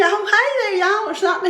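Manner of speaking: put-on high, bright kid greeting; friendly little boy. Little boy greeting her at a party, high and friendly. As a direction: chirp it like a friendly small boy. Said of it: "he was just so friendly".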